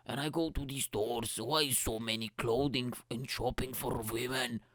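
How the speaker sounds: put-on, hammed-up Scottish brogue; Scottish accent. Shown as a demonstration. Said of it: "let's do a bit more Scottish accent".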